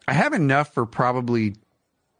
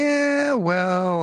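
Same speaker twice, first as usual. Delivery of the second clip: high sassy voice; 11 semitones higher, 4 dB louder than usual. Acted. Playful challenge.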